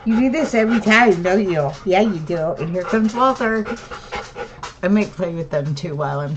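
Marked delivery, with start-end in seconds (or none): 0.1-3.7 pet talk. Real, not acted. Talking to her pet.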